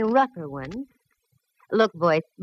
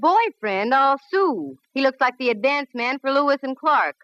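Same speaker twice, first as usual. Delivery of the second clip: pitched-up outrage; indignant; 7 semitones higher than usual. Acted. Candy protests to the conductor, high indignant outrage. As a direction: bristle at the very idea. Indignant at being paired with stranger.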